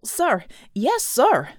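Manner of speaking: loud, high, eager acknowledgement; military acknowledgement. A narrator's reading. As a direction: snap to it like a keen subordinate. Colt answers his commander briskly.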